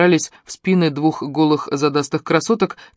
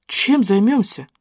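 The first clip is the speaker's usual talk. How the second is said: horrified; 5 semitones higher than usual. A narrator's reading. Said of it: "ужаснулась Лерон".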